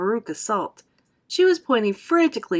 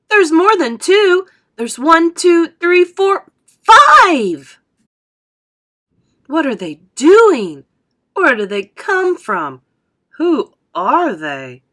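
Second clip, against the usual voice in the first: loud, drawn-out excited calls; shouting; 8 semitones higher, 11 dB louder, slower than usual. A narrator's reading. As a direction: shout slowly in amazement. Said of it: "everybody started shouting at once".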